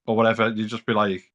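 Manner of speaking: ordinary talk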